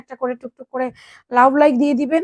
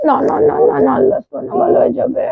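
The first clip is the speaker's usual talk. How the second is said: high, louder no-no-no to calm her; urgent soothing; 6 semitones higher, 4 dB louder than usual. Real, not acted. Mother hushing her crying daughter, high and urgent.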